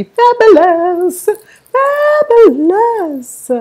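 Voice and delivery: high, drawn-out squeal of delight; gushing fabulous. Real, not acted. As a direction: stretch the last fabulous out high and slow. Thrilled gushing over the card deck.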